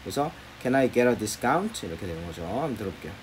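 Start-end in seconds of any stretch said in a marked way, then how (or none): 0.6-1.8 "get a" run together as getter, said slowly; slow demo of "get a" linking; linked get a. Shown as a demonstration. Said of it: "get이 아니고 getter 이렇게 됩니다".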